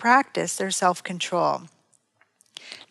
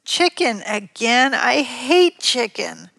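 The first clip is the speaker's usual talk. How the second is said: put-on slow, higher, disgusted child complaint; 5 semitones higher, 4 dB louder, slower than usual. Child objecting to mom's chicken supper, disgusted. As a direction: drag it out in disgust. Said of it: "The child makes a disgusted face".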